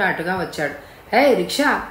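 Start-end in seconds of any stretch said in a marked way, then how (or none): 1.2-1.8 higher-pitched hail to a rickshaw; calling out. Acted. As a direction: call for a ride, without real shouting. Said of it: "పిలిచాడు".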